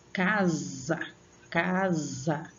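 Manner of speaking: casa repeated with drawn-out syllables; sílaba forte. Shown as a demonstration. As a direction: stretch out each casa to show its stress. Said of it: "colocando força pra pronunciar ela". (casa com a sílaba forte alongada)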